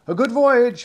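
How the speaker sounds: high, loud call across the water. A narrator's reading. Nick calls goodbye to the parting seamen, calling out. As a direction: call out a farewell across the waves. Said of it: "he called as they parted".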